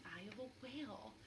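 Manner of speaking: breathy hush on the last words; whisper. Acted. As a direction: lean in and whisper it like a secret. Suspenseful guess about the rock.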